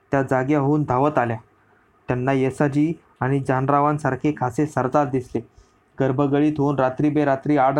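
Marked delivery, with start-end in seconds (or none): none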